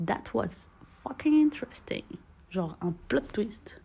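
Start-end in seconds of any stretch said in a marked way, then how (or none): 0.1-2.0 mock impressed. Mock quote of others' reaction.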